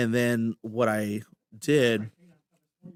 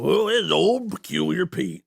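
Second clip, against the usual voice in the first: put-on higher, slow, growly old-timer; old prospector voice; 6 semitones higher, 4 dB louder, slower than usual. Shown as a demonstration. Showing the host his prospector character, slow growly old-timer. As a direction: croak it out like a grizzled gold miner. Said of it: "an old prospector voice where I'm like".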